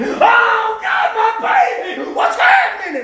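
high, loud, desperate cry; panicked shout. Acted. Another mother at the crib, crying out high and loud. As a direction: scream it in horror at the crib.

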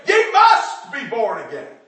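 loud, slow, emphatic must; loud preaching quote. Retold or imitated. Preacher quoting Jesus to the congregation, slow and loud. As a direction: thunder it from the pulpit. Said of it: "Jesus said".

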